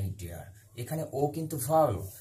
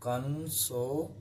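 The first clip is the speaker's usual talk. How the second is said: Consonant drawn out syllable by syllable; slow dictation; much slower than usual. Shown as a demonstration. Teacher dictating consonant to students, slow and drawn out. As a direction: say consonant slowly, broken into its parts. Teacher repeats term slowly for note-taking.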